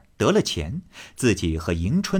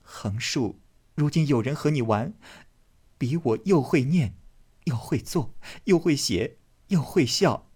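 higher, hurt, petulant; tearful reproach; 6 semitones higher than usual. Acted. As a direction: reproach him through tears. Daiyu weeping reproach.

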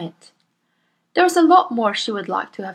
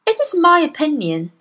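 put-on higher, earnest young teacher voice; Miss Honey; 8 semitones higher, 5 dB louder than usual. Young teacher protests to her headmistress, earnest and higher. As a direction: politely protest to a bully.

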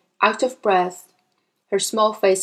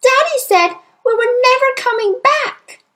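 put-on very high, loud child; upset little girl, high and forceful; distressed cry; 6 dB louder than usual. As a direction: cry out the awful news. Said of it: "Matilda cried".

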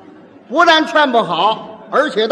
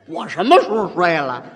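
mild indignant denial; 4 semitones lower than usual. Shown as a demonstration. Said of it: "语气不对".

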